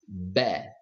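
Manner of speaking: Bet said alone with a clear eh vowel; word drill. Shown as a demonstration. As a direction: model Bet for students to repeat. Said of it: "let's read the words".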